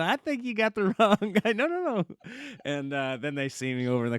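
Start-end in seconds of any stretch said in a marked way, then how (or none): 0.0-2.0 high, laughing denial of the mix-up; laughing protest. Retold or imitated. The couple laughing off the honeymoon suite, high and giggly. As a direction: giggle through it, then squeak no no no.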